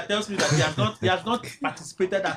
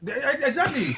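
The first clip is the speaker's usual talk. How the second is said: laughing; 9 semitones higher, slower than usual. Real, not acted. Laughs agreeing with host.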